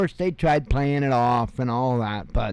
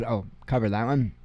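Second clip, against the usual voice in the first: ordinary talk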